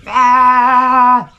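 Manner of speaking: loud, high mock yelp of panic; mock panicked shriek. Showing how she won't barge into the oncologist, mock shriek. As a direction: let out a hammed-up panicky shriek. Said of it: "I won't just go in there going".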